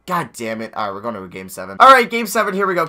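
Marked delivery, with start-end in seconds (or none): 1.8-2.9 excited. Real, not acted. Hyped start of game seven.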